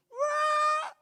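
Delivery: loud, very high mock crying; imitated child's wail. Pastor imitating his child crying upstairs, loud wail. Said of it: "Anak saya nangis".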